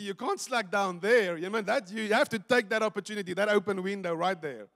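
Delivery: ordinary talk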